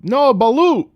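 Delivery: "balut" said high and loud, stressing the u; a loud high correction: "No, balut"; correcting pronunciation. Retold or imitated. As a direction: insist it's "balut" with a sharp high yelp. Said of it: "And he was saying".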